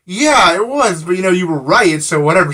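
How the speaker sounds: high, loud, emphatic comeback; retold pointed agreement. Passenger confirms the woman's assumption, pointedly. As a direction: call out the assumption, then brush it off.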